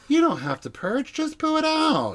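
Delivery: high, breezy mock suggestion; mock Erica's advice. Cohost retelling Erica's advice on purging, mock breezy. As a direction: offer it blithely, jumping up high at the end. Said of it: "she's like".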